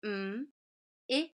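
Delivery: Mm, it as isolated letter sounds m, i, t; phonics-style separate sounds for "Mm, it"; sounding out. Shown as a demonstration. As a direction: say each sound of "Mm, it" alone, with long gaps. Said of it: "I'm gonna sound it out".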